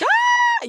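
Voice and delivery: high, loud mock shriek; a sudden squeal of panic; hammed-up yelp of alarm; panicked shriek. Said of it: "I was like".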